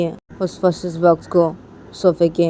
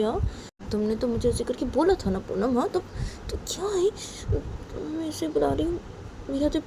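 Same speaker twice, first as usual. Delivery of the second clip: higher, softer worried muttering voice; fretting Sonam; 5 semitones higher, 6 dB quieter than usual. Acted. Sonam frets when Poonam ignores her calls, higher and softer. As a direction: fret to yourself, softer and higher.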